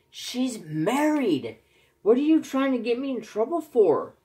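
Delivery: retold high-pitched exasperated outburst; exasperated scolding. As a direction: scold him for getting you in trouble. Scolding friend typing for him.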